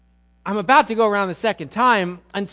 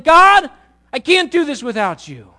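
desperate plea; 7 semitones higher, 10 dB louder than usual. Acted. Voiced prayer at sermon peak.

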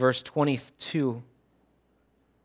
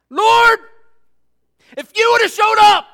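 much higher, much louder anguished shout at God; angry anguished cry; 16 dB louder than usual. Shown as a demonstration. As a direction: cry it out in hurt and anger. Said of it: "You can hear the anger, the hurt, the pain".

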